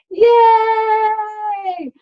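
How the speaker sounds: loud high cheer, arms up; joyful yay. Acted. Cheer after chant.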